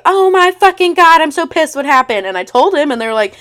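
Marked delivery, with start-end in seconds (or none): 0.1-2.2 much higher and louder shocked outrage; imitating indignant sorority sisters; sorority friends reacting to the screenshot, indignant and loud; outraged friends.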